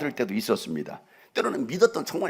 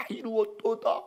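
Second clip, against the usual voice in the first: Jesus' dying words; 8 semitones higher, slower than usual. Retold or imitated. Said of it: "고통의 신음소리를 내시면서".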